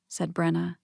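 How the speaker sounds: ordinary delivery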